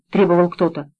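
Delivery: ordinary delivery, nothing marked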